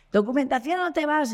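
high, pushy mock demand from a policeman; policeman's mock yelled ultimatum; imitating a shouting cop. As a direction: press the ultimatum like a bossy officer. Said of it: "el tío me gritaba".

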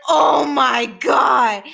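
loud, drawn-out panicked cry of oh my God; retold horrified outburst. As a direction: cry it out in shock, slow and loud. Retelling panic at the gush.

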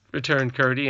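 ordinary delivery, nothing marked

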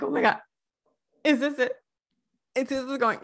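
giddy fan hoping this is the moment; excited disbelief. Retold or imitated. Fan reacting to the romance scene, giddy and high.

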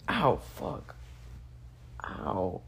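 soft pained wince; real sting of pain, quiet. Real, not acted. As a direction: react to getting hit in the eye. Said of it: "I just hit my fucking eye".

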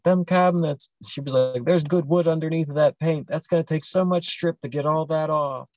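a hint of a crying mother's whine; imitating whimpering mom. Retelling how the upset mom whimpered over her cabinets.

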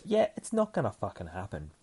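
plain reading voice

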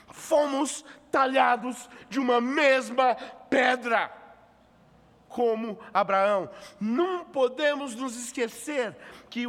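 high, loud fervent preaching; preaching at full force. Acted. Pastor urging the congregation about the missionary call, fervent loud preaching. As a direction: rise into a passionate sermon climax.

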